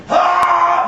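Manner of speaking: high loud scream; demo scream. Retold or imitated. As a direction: let rip a booth scream.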